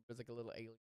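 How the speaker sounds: normal talking voice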